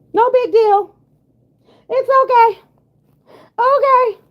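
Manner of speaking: high, mocking put-on voice shrugging it all off; mocking dismissive voice.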